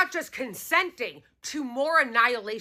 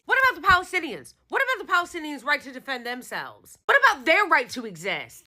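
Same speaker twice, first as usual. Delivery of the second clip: ordinary talk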